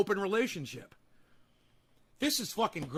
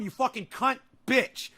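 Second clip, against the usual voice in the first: usual speaking voice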